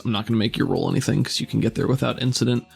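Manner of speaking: ordinary talk, nothing marked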